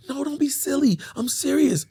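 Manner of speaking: put-on high, dismissive girlfriend voice; high mock-earnest woman; imitating woman friend. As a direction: wave him off in her high voice. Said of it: "She's like".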